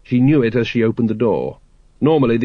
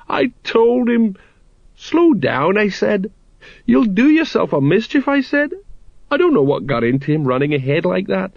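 lamenting sergeant; 8 semitones higher than usual. Acted. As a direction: wail it like a grieving old sergeant. Colon grieving, quoting himself.

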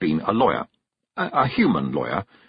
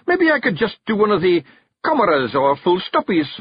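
high, loud Scots bargaining; a wee clansman begging for an easier task; pleading Feegle; 10 semitones higher, 5 dB louder than usual. Acted. Rob's voice.

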